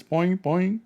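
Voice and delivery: high squeaky poing noise; squeaky boing. Retold or imitated. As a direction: imitate a springy rubber squeak. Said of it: "it make noise".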